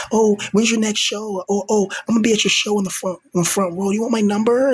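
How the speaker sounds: high, gushing fan voice; imitating an eager girl fan. Imagining an eager fan begging for his number, high and gushing. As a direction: gush like a fangirl at his show. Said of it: "girls watching me now be like".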